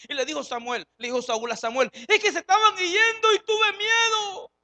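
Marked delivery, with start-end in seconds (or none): 2.1-4.5 frightened plea. Acted. Saúl excuses himself in fear.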